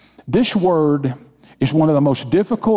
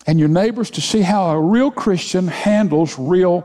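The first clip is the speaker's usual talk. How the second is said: higher, building preacher's fervor; preaching at full force; 7 semitones higher than usual. Acted. Pastor urging his church to trust God, fervent preaching. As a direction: drive it home to the congregation. Rising sermon intensity.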